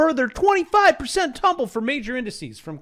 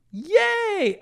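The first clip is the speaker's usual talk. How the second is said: high, mock cheerful yay; sarcastic yay; 4 dB louder than usual. Sarcastic cheer at recession news. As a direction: fake a cheer.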